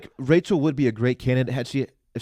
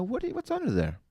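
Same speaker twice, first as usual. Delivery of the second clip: squeaky mock voice, much higher; 9 semitones higher than usual. Host teases a contestant's heavy makeup in a high mock voice. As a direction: pitch way up to tease what she's hiding. Teasing about girl's makeup.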